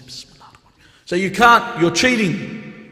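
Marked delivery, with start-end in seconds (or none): 0.1-0.5 very soft, barely audible mutter; imitated whisper. Child whispering before a marbles turn, imitated whisper. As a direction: whisper it like a child sneaking in a prayer. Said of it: "We used to whisper it".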